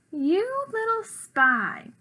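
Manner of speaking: high, slow, drawn-out yell; angry shout. A narrator's reading. Rosabelle shouts at her little brother, angry. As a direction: stretch the accusation out in a storybook shout. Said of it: "Then Rosabelle shouts".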